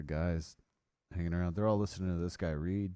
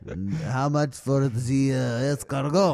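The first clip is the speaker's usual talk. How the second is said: put-on French accent; 3 semitones higher, 7 dB louder than usual. Mocking French diners.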